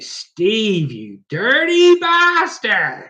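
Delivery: high, loud, drawn-out teasing rebuke; mock outrage. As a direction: stretch it out in hammed-up scandalised horror. Mock scolding after a cheeky question.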